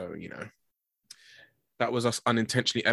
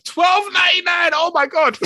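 real high, loud shriek of disbelief; outraged shout; 7 dB louder than usual. Real, not acted. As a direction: yell it out in shock at the price. Outraged live reaction to the price.

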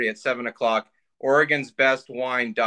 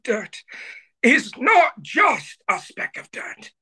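high, slow hushed nature narrator; David Attenborough impression; 11 semitones higher, slower than usual. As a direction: narrate the duck like a wildlife film. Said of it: "That's David Attenborough".

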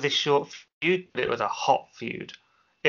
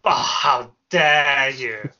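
slow, loud mock outrage; hammed-up indignant how dare you; shaking with anger; 6 dB louder, much slower than usual. Mock outrage at a rival's claim.

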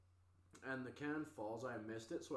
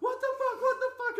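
very high, loud repeated outcry; shocked yelling; 13 dB louder than usual. Retold or imitated. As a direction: imitate a panicked crowd shouting. Voices everyone yelling after shots.

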